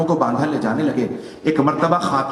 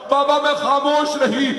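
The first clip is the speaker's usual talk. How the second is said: pitched far higher, a grieving cry; wailed refrain; 11 semitones higher than usual. Acted. Fatima telling her father she stayed silent, wailing. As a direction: let the refrain break into a keening wail.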